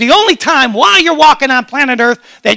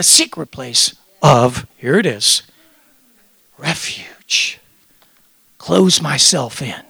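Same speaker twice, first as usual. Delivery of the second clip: hushed; 8 semitones lower, slower than usual. Real, not acted. Pastor hushes on secret place.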